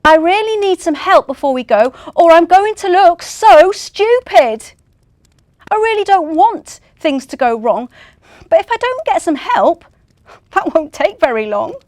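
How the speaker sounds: high, loud frustrated plea; frustrated reindeer shouting his last plea; desperate shout. Acted. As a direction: beg for help at full volume. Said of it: "And he shouted".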